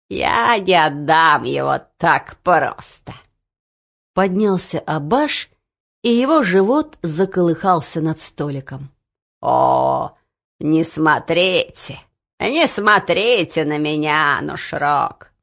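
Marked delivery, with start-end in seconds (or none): 0.1-3.1 slow stubborn refusal, in character; koppige weigering. A narrator's reading. Abash refuses to hand over key. 9.4-15.3 slow, drawn-out plea; pleading dread. A narrator's reading. Abash recoils from Nushrok's stare.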